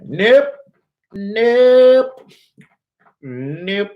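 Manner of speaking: high, sing-song nope, nope, nope breaking into a laugh; mock sing-song refusal. To himself, refusing to stay at the company: high mock sing-song, breaking into a laugh. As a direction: chant it high and teasing, laughing at the idea. His inner voice refusing the offer.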